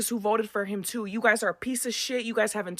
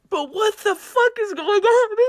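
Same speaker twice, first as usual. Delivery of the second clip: much higher, louder and slow, exploding in disbelief; emotional outburst; 11 semitones higher, 7 dB louder, slower than usual. Real, not acted. Ranting at voters about the election, a high loud outburst. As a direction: let the frustration burst out high and loud. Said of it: "Forgive me for being a little emotional here".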